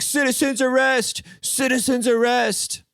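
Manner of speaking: high, slow hammed-up cry; mock a busybody calling out, high and slow; mock citizen's arrest. Podcaster mocks a wannabe hero, hammed-up high call. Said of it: "he's probably like".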